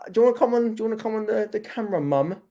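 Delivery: high, singled-out aside to mum; coaxing. Real, not acted. Streamer invites mum onto camera, high-pitched coaxing.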